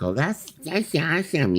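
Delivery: high, slow funky voice; drawn-out high little-guy praise; slow high funky approval; David Sterling impression. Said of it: "this is the way he talks".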